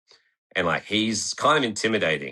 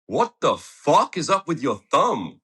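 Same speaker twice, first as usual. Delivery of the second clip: imitating a kid; 9 semitones higher, 6 dB louder, slower than usual. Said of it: "one dude just goes".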